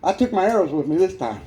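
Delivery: ordinary delivery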